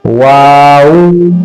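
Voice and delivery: वाऊ drawn out very slowly, lips rounding twice; teacher demo of वाऊ; model pronunciation. Shown as a demonstration. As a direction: stretch वाऊ long with two lip roundings. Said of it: "आप अदा करें इसको".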